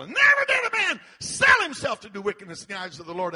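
emphatic pulpit cry; preaching shout. Real, not acted. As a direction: burst out high and loud. Preacher thunders out scripture.

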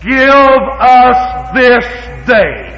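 very slow, loud rising give; slow emphatic prayer. Shown as a demonstration. Preacher demonstrating how Jesus taught prayer. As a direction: draw each word out. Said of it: "This is how he said to pray".

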